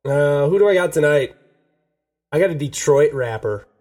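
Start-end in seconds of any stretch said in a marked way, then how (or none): none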